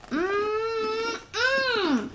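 drawn-out blissful mmm, high; rapturous tasting food. Real, not acted. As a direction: savor it, overjoyed. Kids taste-testing Oreos.

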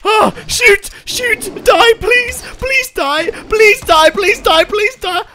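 high-pitched frantic yelling; frantic begging shouts. Real, not acted. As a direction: beg it to die in a panic. Frantic fighting, begging tiger to die.

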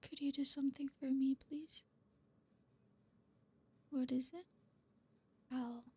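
put-on hushed, tender comforting boyfriend. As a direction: murmur close as if holding a crying partner. Tender whispered comfort roleplay.

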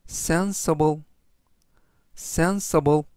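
sensible said slowly and clearly, each syllable spelled out; teacher modelling sensible; careful pronunciation demo. Shown as a demonstration. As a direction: model the word sensible twice for learners. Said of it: "이라고 발음하시면 되겠고요".

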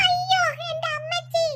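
put-on boy falling from bed, crying out; pained cry. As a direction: cry out after a fall.

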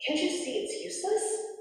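high-pitched, scoffing eagle call; mild storybook shout. A narrator's reading. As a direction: lightly call out a dismissive objection. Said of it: "one of the eagles shouted".

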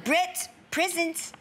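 slow, high attempt at a New Zealand accent; tentative New Zealand accent impression. Shown as a demonstration. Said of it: "Could you do a New Zealand accent?".

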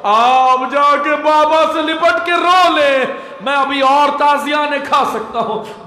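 high, drawn-out wailing plea; tearful cry. Acted. Nephew urging his aunt to weep over her father, tearful cry. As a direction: start as a loud slow wail, then ease a little. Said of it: "रोती आंखों से कहा".

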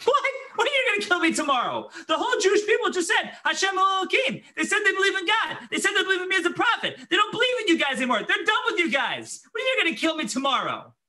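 high, fast, laughing disbelief; half-laughing, half-shouting at the absurd threat; incredulous outburst. Retold or imitated. Eliyahu laughing at Jezebel's threat, incredulous and fast.

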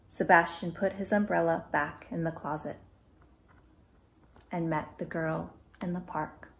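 usual speaking voice

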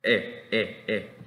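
brief "E" with a slight smile; short E sound. Shown as a demonstration. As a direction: say each "E" short, lips in a small smile. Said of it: "como haciendo una sonrisa no tan prolongada".

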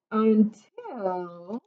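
引き伸ばした「until」、上がり調子で間を溜める; 「until」をゆっくり伸ばし、語尾を上げて次を待たせる; drawn-out suspense. A narrator's reading. Suspense before the twist.

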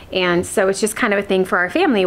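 normal talking voice

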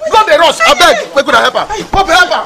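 high, loud begging for help; frantic pleading shout. Acted. Woman begging the crowd to help the bleeding man, frantic shout. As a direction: cry out for someone to help her.

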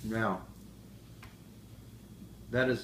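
speaking between bites; chewing while talking. Real, not acted. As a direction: mouth partly full of food. Seen 0: Man chews with closed mouth early, then talks clearly afterward.

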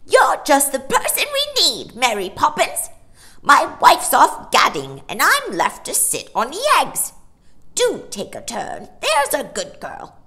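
high, loud, fussy bird voice; chattering macaw. A narrator's reading. The macaw begs Mary Poppins to take a turn, high and loud. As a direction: squawk out the favour like a harried parrot.

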